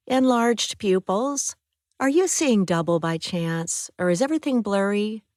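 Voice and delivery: a hint of a brisk clinical nurse checking a patient.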